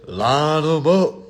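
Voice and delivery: slow triumphant rally; drawn-out hype. Real, not acted. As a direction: savour each word. Repeated slower after laugh.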